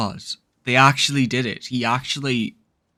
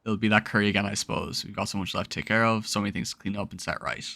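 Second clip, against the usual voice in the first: low, soft, understated game dialogue; subdued dialogue reading; 5 semitones lower, 8 dB quieter, faster than usual. Acted. Player reads a character's words about work left, subdued and low. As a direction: read it quietly, low and soft.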